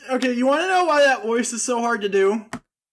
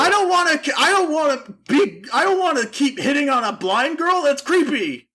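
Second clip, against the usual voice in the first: high, loud mock outrage; comic indignant outburst; acted protest; 5 semitones higher, 3 dB louder than usual. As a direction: protest in hammed-up disgust. Acting a heated protest to Joey.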